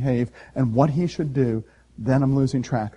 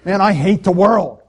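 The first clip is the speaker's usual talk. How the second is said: mock disgust; 4 semitones higher, 6 dB louder than usual. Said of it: "I walk away ... and I say".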